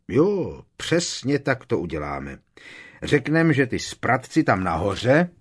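a hint of a pleased bully; gloating thug. A narrator's reading. Amicus settling on his lie, gloating. Amicus plotting to blame students.

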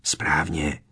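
low, hushed one-word reply; quiet hiss. A narrator's reading. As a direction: keep it down, under your breath. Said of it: "sykl tiše Harry".

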